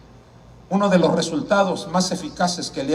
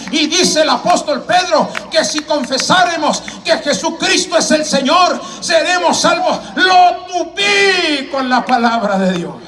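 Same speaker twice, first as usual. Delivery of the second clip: high, driving scripture proclamation; raised, ringing pulpit delivery; preaching at full force; 10 semitones higher, 7 dB louder than usual. Acted. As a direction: fire off verses like a fervent evangelist.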